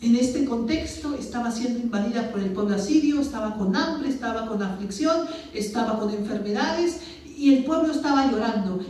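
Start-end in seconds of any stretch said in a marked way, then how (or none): none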